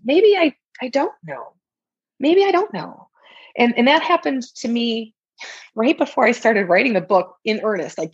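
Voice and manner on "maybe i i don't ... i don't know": high, startled realisation that you might be wrong; sudden doubt. Retold or imitated. A client suddenly doubting their own story, voice jumping up high. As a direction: catch yourself mid-thought, voice jumping up high.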